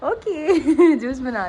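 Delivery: high, loud okay dissolving into giggles; giggling. Real, not acted. Wife making up with husband while making juice, giggling. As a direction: laugh your way through the words.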